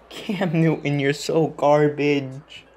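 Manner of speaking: slow, dragged mocking words; drawn-out insult. Real, not acted. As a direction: stretch each word out in disgust. Insulting Cam Newton.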